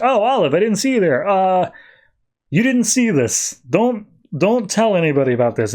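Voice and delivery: high-pitched flustered teacher; caught off guard. Retold or imitated. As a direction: imitate being caught doing something you shouldn't.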